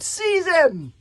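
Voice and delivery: loud order to seize him; commanding shout. A narrator's reading. Warlord orders his warriors to seize the boy, loud.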